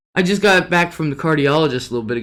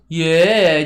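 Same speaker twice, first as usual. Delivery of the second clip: usual speaking voice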